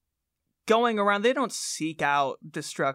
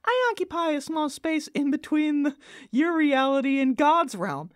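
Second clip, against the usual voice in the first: Cosmorath voice; 9 semitones higher than usual. Acted. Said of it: "I'm Cosmorath".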